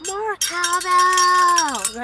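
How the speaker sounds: loud, high, drawn-out catchphrase; mock shout. Repeating the sketch catchphrase to chat, loud and slow. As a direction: stretch it out like a punchline.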